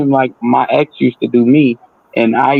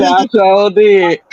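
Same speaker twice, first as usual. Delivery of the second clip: drawn out, knowing; slow sly claim; 6 semitones higher, much slower than usual. Retold or imitated. Barber taking credit for the haircut, slow knowing claim. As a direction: savor it with a wink in the voice. Deadpan claim in retold scene.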